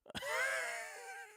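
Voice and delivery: high, cracking burst of laughter; shrieking laugh. Real, not acted. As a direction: cackle at your own joke. Mocking Miss Bell's drinking.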